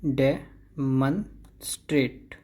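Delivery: डेमोन्स्ट्रेट slowly as "deh... mun... strate"; syllable-by-syllable. Shown as a demonstration. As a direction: break डेमोन्स्ट्रेट into three slow parts. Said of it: "ये है डे".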